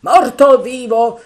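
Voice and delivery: high, loud defiant cry; Mary shouting that Ben Gunn doesn't matter; shouted scorn. Acted. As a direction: fling the words out. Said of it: "gridò Mary".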